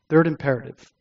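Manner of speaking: soft, settled restatement of a heading; quiet repeat. Real, not acted. Repeats heading after composing himself.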